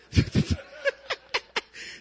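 high breathy giggle; high laugh. Real, not acted. Laughing about childbirth pain.

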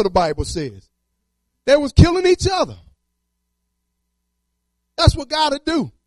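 preaching at full force. Acted. Preacher urging the congregation to trust God, building loud. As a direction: drive the faith message home to the church.

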